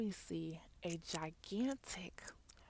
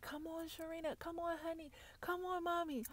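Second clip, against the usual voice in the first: high-pitched mock panicked calls; imitated urgent pleading; 11 semitones higher than usual. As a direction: mimic scared family urging someone along. Imitates family urging her to run.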